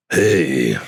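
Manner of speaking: low grotesque monster greeting; hormone monster voice. Pretend. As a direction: growl a lazy monster hello. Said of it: "I do the hormone monster's voice pretty good".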